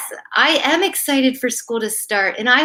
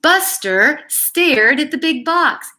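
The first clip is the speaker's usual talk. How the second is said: put-on reading a picture book aloud to kids; gentle storyteller read; story reading; 4 semitones higher, slower than usual.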